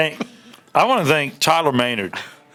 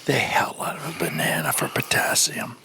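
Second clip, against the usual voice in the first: breathy close-mic whisper; ASMR whisper; 3 semitones lower, 5 dB quieter than usual. Pretend. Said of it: "doing my A S M R voice".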